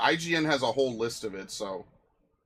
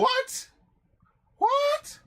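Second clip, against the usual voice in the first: very high, louder falsetto yelp; high-pitched squeal of mock disbelief; mock-incredulous squeak; 7 dB louder than usual. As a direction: squeak it up insistently. Repeated squeaked reply to being corrected.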